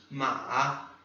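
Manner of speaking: "Ma" dipping low, then rising; third tone. Shown as a demonstration. Teacher repeating the horse word, "Ma" in a falling-rising tone. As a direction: drop and lift the tone on "Ma". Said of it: "Desce e sobe".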